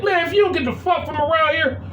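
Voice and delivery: high-pitched indignant telling-off, not shouted; retold angry outburst. Stepdad retells telling off stepson over internet, indignant.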